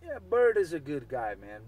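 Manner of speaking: ordinary talk, nothing marked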